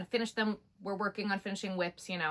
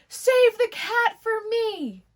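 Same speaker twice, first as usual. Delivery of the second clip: high, loud, slow sing-song call; loud high drawn-out plea; calling back while dragged off; 12 dB louder, much slower than usual. Retold or imitated. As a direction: shout back over your shoulder. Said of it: "she's like".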